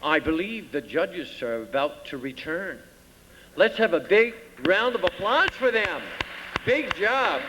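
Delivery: slow, drawn-out emcee voice; announcer voice; pageant host announcing. Acted. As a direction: build suspense for the judges.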